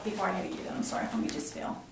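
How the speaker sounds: usual speaking voice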